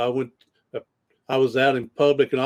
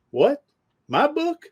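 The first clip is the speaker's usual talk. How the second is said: high, rising squeak of mock disbelief; acting surprised; 12 semitones higher, slower than usual. Pitch jumps way up in a mock-astonished question. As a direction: coach hamming up shock at a stranger's praise, mock surprised. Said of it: "I'm acting surprised".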